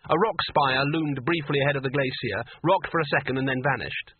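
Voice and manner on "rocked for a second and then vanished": ordinary delivery, nothing marked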